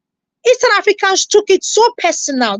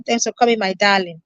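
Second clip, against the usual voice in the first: tender thanks; 9 semitones lower, 5 dB quieter than usual. Real, not acted. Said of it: "thanking a viewer tenderly".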